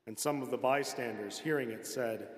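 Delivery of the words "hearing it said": ordinary talk, nothing marked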